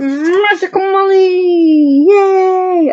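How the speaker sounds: high, slow, thrilled cheer with a catch; excited cheer. Real, not acted. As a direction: gush over her name, drawn out. Cheering for favourite YouTuber.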